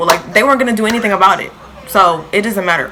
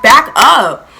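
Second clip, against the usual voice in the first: ordinary delivery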